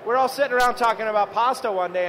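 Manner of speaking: ordinary talk, nothing marked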